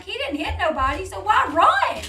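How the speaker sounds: loud, high indignant question; exasperated protest. Retold or imitated. Woman arguing her boyfriend had no reason to run, exasperated.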